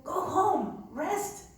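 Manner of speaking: high, pleading push to go home; pleading urge. Retold or imitated. Nun begging worn-out volunteers to go home, high and pleading. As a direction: beg them gently but high to stop. Urging exhausted volunteers to rest.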